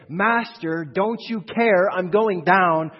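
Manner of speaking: loud, slow, high desperate cry; panicked plea. Pretend. Disciple crying to the Master in the storm, desperate. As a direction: cry out to be saved from drowning.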